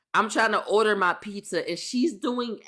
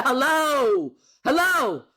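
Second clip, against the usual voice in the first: calling out; 6 semitones higher, 4 dB louder, much slower than usual. Retold or imitated. Calling the distracted cashier.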